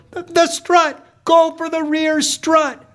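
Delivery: very high, stammered tip-off from mom; frantic yell. Acted. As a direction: scream tactical advice from the stands. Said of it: "My mom yells".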